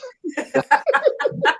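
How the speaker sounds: squealing laugh; high delighted laugh; high burst of laughter. Real, not acted. Laughing at the song.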